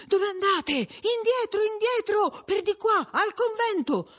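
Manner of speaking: high, thin-pitched frightened urging; faint trembling voice. A narrator's reading. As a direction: urge them back, all a-tremble, without shouting. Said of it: "con voce mezza fioca dice".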